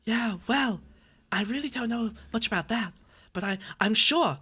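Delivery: soft dazed starlet voice; Marilyn Monroe impression. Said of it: "She sounds concussed".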